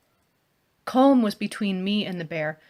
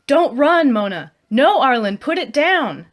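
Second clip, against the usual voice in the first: high, loud yelled orders; frantic shout; 6 semitones higher, 9 dB louder than usual. Acted. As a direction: scream at them to stop. Bear charges, shouting at companions.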